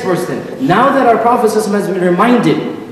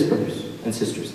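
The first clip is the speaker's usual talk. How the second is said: whisper; 10 semitones lower, 14 dB quieter than usual. Real, not acted. Listen to this aside.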